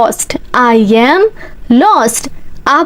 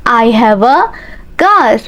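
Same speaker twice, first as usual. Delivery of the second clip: slow chunked drill. Shown as a demonstration. Teacher drilling learners, slow chunked repeat. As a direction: break it up so students follow.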